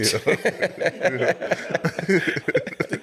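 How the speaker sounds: helpless high chuckling; real laugh. Real, not acted. Comic recalls backhanded praise, real laughter. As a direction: laugh freely at your own story. Laughs at his own story.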